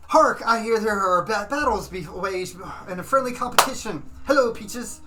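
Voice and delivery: loud, high heraldic proclamation; paladin hail. Acted. Paladin greeting the tavern crowd, booming herald. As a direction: announce yourself grandly to the tavern. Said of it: "as the paladin comes in and says".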